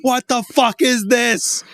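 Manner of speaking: slow, high, loud yell at the screen; the ape yelling at the monolith; mock yell. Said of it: "just, like, yelling at it".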